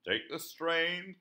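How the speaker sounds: loud, slow call to the team. A narrator's reading. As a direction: call out the order loudly. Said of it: "called Percy".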